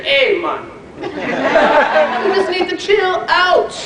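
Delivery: laid-back put-on Jamaican accent; playful mock Jamaican accent, drawn out. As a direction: do a hammed-up, chilled Jamaican mentor. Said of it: "terrible Jamaican accent".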